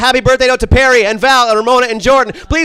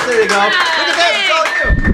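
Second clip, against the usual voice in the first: ordinary talk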